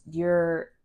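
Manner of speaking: ordinary delivery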